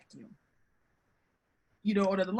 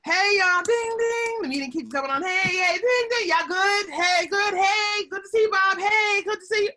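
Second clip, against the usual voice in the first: high, bright sing-song hellos, much higher and louder; chirpy meeting greetings; 10 semitones higher, 8 dB louder than usual. Retold or imitated. Speaker mimicking colleagues joining a video meeting, cheery high sing-song greetings. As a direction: toss off cheery hellos as people join the call. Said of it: "when a little something like this".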